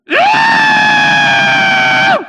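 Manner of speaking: long high-pitched startled scream; loud scream. Acted. Merchant startled by his camel, screaming. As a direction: scream it at full volume, held long. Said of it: "Hope that wasn't too loud".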